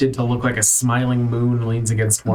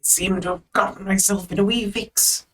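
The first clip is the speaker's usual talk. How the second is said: high, softer puppet voice; skeleton marionette voice; 8 semitones higher, 3 dB quieter than usual. Acted. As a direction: play a tangled-up skeleton puppet.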